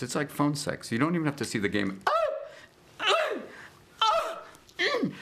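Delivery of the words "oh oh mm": high tennis grunts. Retold or imitated. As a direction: shriek like a tennis player hitting. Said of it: "everyone's very vocal".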